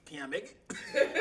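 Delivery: laughing, high asking; laughing plea. Retold or imitated. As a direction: ask through laughter. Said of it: "I'm like".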